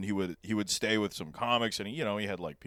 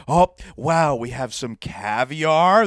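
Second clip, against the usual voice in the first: slow mock host; 3 semitones higher, 7 dB louder, much slower than usual. Mock host presenting tuna.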